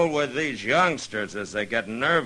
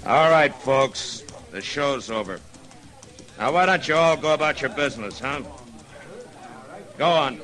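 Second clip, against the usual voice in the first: loud and higher, breaking up the crowd; addressing a crowd; 7 semitones higher, 5 dB louder than usual. Acted. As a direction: send the onlookers home with authority. Marshal dispersing the watching crowd.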